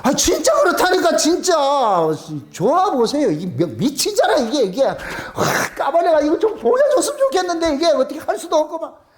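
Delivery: high, excited gushing; worked-up, exuberant testimony; bursting with joy. Acted. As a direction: insist on it, overflowing with feeling.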